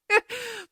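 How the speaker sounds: sudden high-pitched laugh; high burst of laughter. Real, not acted. Podcaster laughing at her own rant to listeners, high laugh. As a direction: let a bright laugh burst out. Laughs after lotto joke.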